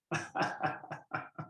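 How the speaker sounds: real chuckle in a short burst; laugh. Real, not acted. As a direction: laugh lightly at your own tease. Laughing after teasing John's accent.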